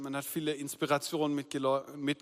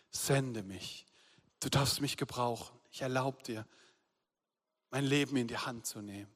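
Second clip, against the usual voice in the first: quiet prayer; 6 semitones lower, 5 dB quieter than usual. Retold or imitated. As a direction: pray it quietly, giving yourself over. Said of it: "Dieses Gebet zu beten".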